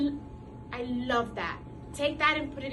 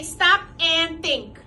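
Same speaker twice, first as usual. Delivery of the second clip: slow, louder, each word laid down; slow model phrase; 2 semitones higher, 6 dB louder, slower than usual. Shown as a demonstration. Teacher modelling a phrase for children, slow and emphatic. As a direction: spell it out slowly for the class to repeat. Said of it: "I want you to say".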